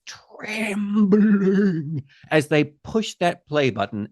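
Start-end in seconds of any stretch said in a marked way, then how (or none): 0.1-2.0 mock trembling. Host acts out the trembling finger.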